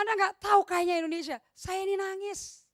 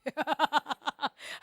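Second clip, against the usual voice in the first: laugh. Real, not acted. Laughs after her sung joke.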